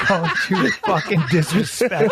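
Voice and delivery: laughing mock threat. Joking mock threat banter.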